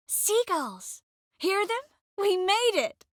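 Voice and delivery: high, excited exclamations; elated. Acted. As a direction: cry out with delight at making it. Elated at arriving.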